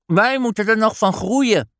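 put-on high girl voice talking through a mouthful; a child chewing while she answers; mouth full. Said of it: "zegt Nelly met volle mond". (hoog meisjesstemmetje met volle mond)

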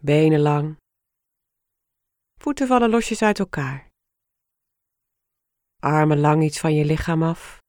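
ordinary delivery

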